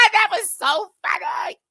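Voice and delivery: shrieking high laughter; loud high laugh that breaks into words; laughing hard. Real, not acted. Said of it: "did you hear how she said cracker".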